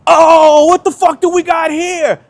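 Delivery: high, loud sneering gangster voice; tough gangster greeting. Retold or imitated. Gangster kids greeting the captured skater, loud mock sneer. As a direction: crow over the catch like a thug.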